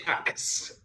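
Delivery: whispered "ax" for ask; whispered pronunciation. Shown as a demonstration. Brit questions the dialect form, whispering it. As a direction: whisper "ax" with a k then s. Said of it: "why do people say".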